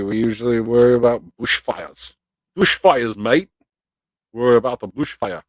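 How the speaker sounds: loud, hammed-up Aussie accent; badly faked Australian twang; terrible Australian accent. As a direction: put on a bad Australian accent. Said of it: "That was a terrible accent".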